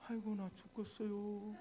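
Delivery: soft, worn-out moan; mock exhaustion. Pastor imagining himself after worship, softly moaning in exhaustion. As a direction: feign being utterly spent.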